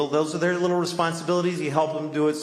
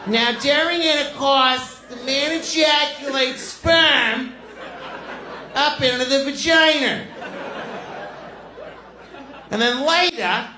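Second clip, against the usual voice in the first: Boston teacher; 11 semitones higher than usual. Retold or imitated. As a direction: lecture the kids like a brassy Boston teacher. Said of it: "She's like".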